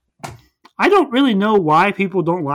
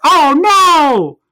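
much higher, much louder cartoon gasp of dismay; mock dismay; 11 semitones higher, 10 dB louder than usual. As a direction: wail it like a busted cartoon villain. Villain caught out in recap.

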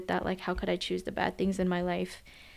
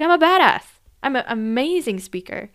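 proud exclamation; 5 semitones higher, 8 dB louder than usual. Retold or imitated. Client declaring her strengths.

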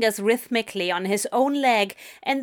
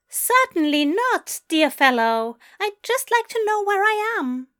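put-on polite British accent, every word carefully placed and pitched up; precise British accent; 11 semitones higher, 3 dB louder than usual. A narrator's reading. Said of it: "British accent".

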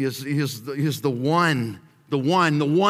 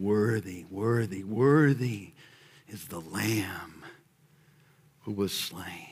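much softer, lower, fading to a whisper; hushed reverence; 8 semitones lower, 7 dB quieter than usual. Acted. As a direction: breathe it like a sacred hush. Heavenly song quoted reverently.

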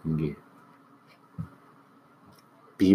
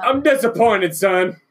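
disappointed dad; 12 semitones higher, 7 dB louder than usual. Pretend. Mock parent scolding absent son.

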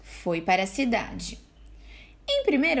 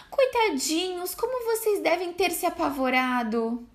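comforting mother; 7 semitones higher than usual. A narrator's reading. Mother hugging frightened kids.

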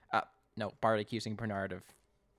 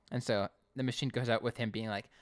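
ordinary delivery

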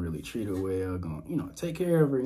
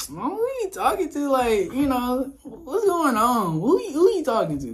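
teasing son; 14 semitones higher, 5 dB louder than usual. Retold or imitated. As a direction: pester mom playfully about her new man. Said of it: "'cause I'm like".

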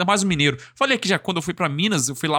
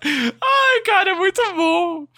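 high, squealing real laughter through the words; laughing hard; 11 semitones higher than usual. Real, not acted. Streamer reacting to a funny Mineiro clip, laughing hard. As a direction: talk while cracking up.

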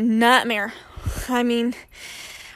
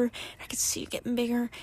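whisper; 10 dB quieter, faster than usual. Real, not acted. Watching tornado grow, hushed.

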